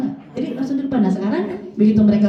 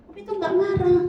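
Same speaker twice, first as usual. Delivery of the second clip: suara anak tinggi; anak meniru; 8 semitones higher than usual. Retold or imitated. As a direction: tirukan anak yang protes. Anak mengejek ibunya. (suara anak yang tinggi)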